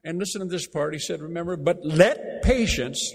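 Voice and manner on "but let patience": slow, weighty "let" as a command; "But let patience" drawn out, "let" stressed. Shown as a demonstration. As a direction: lean on "let" like an order. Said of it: "let is actually a military command".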